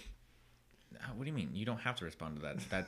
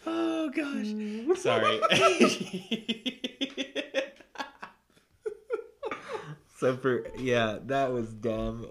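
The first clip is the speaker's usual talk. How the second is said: high, loud, helpless laughter through the words; can barely get it out for giggling; laughing; 13 semitones higher, 8 dB louder, slower than usual. Real, not acted. Apologizing to a friend for the joke, laughing hard.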